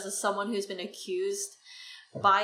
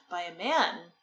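usual speaking voice

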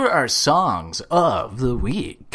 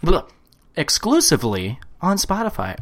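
hard sell ad; 5 semitones higher, 3 dB louder, faster than usual. Pretend. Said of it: "commercial voice".

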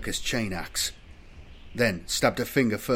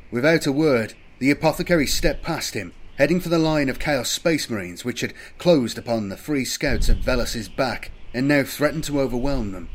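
ordinary delivery

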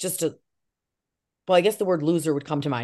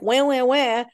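higher-pitched imitation wail; mock whining; 6 semitones higher, 3 dB louder, slower than usual. Actress refusing to complain about scripts, mock whining. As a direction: imitate a crybaby's wah-wah. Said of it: "go around like".

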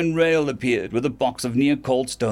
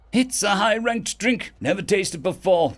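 put-on drunk slurring; 8 semitones higher than usual. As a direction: slur it like you've had too many. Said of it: "they slurred".

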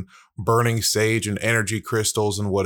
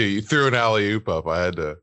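ordinary talk, nothing marked

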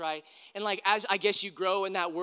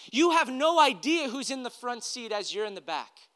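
higher, louder, pressing questions; preaching at full force; 5 semitones higher, 4 dB louder than usual. Acted. Pastor challenging listeners about blessing others, full preaching voice. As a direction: challenge them, each question pushed harder.

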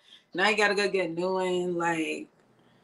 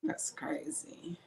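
whisper; 14 dB quieter, slower than usual. Real, not acted. Whispered reaction to joke.

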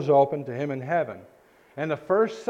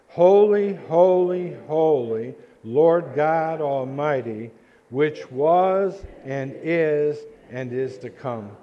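congregational recitation; 4 dB louder, much slower than usual. Real, not acted. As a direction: lead the crowd in a drawn-out, ringing recitation. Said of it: "say it with me, everybody".

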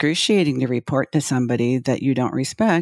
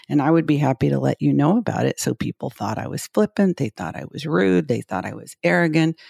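ordinary talk

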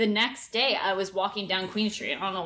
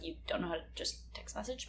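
quiet inner thought; 12 dB quieter than usual. Retold or imitated.

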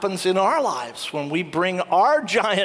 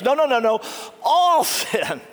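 laughing insistence; 4 semitones higher than usual. Retold or imitated. Priest laughing, insisting on forgiveness.

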